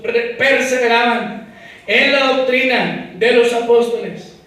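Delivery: loud, slowed preaching of scripture; full-force preaching. Acted. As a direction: proclaim the verses with force. Preaching while reading Acts.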